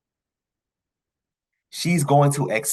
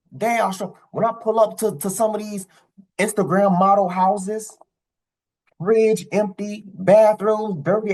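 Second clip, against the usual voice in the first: heated rant; 8 semitones higher than usual. Real, not acted. Ranting about Instagram models' homes.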